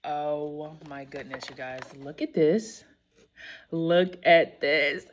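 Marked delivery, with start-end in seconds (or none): none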